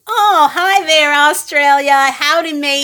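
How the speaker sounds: high, loud, drawn-out hello; hammed-up greeting. Co-host hailing Australian listeners, hammed-up greeting. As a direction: call out a big playful hello.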